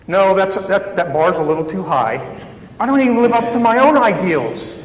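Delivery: a hammed-up sigh of giving up; mock exasperated protest. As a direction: voice jumps up high and loud in mock frustration. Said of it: "You would think we might say".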